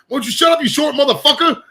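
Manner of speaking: high, loud imitation of a big guy's insult; mock yell. Retelling a bar fight, imitating the big dude yelling. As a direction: belt it out like the big dude snapping at the short guy. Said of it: "It was just so funny the way he said".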